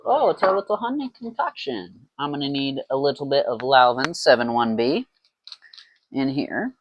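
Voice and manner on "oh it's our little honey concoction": voice crack. Real, not acted. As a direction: voice cracks up high on little honey concoction. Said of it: "Oh, little voice crack".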